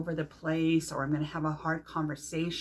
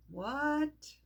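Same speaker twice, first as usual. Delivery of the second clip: high, rising pretend astonishment; mock surprise; 10 semitones higher than usual. Teacher voicing a listener's reaction to Earth as sentient, mock surprise. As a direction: play the startled listener for a moment.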